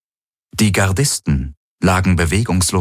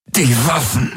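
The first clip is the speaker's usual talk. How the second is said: higher, panted through clenched teeth; gasping through teeth; 7 semitones higher than usual. A narrator's reading. Galen urging Kirai to grab the weapons, gasping through clenched teeth. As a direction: squeeze it out between gritted teeth in pain. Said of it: "keuchte er mit zusammengepressten Zähnen".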